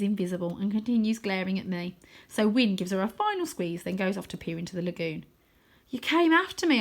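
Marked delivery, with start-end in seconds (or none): 5.9-6.8 startled disbelief; lightly voiced boy, surprised. A narrator's reading. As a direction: voice jumps up in surprise. Arthur surprised Rose followed him.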